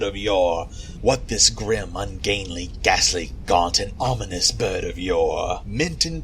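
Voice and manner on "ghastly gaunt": ordinary delivery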